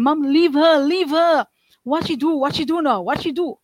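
high, loud begging yell; brothers screaming. Retold or imitated. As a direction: plead with the mother to stop. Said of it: "my brothers would scream".

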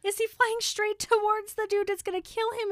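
frantic worried question; panicked Hermione. Retold or imitated.

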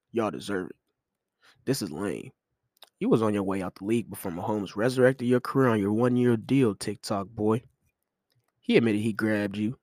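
dry mock politeness; passive aggressive. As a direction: read it like a polite insult. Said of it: "He tried to be passive aggressive".

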